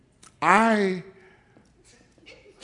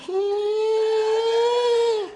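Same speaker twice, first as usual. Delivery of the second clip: mock hum. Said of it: "And I'm like".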